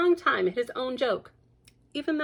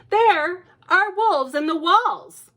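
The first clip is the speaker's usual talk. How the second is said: put-on higher, louder and slow, childlike; insistent little girl; 10 semitones higher, 8 dB louder, slower than usual. Lucy warning her mother about wolves. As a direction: announce it like a worried child.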